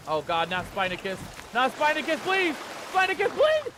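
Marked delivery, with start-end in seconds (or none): 1.5-3.7 loud, very high begging; panicked plea. Real, not acted. Player pleading for Spinacus, panicked.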